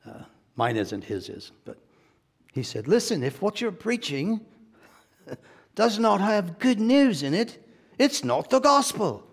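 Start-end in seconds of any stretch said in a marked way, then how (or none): 2.9-9.2 high, loud English-preacher impression; imitation English preacher, climbing higher and louder; Derek Prince. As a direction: proclaim it in a raised, mock-English preacher voice. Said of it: "I won't try to do the English accent".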